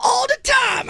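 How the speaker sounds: very high loud shouted response; mock church shout. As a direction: shout back like a joyful congregation. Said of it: "They go".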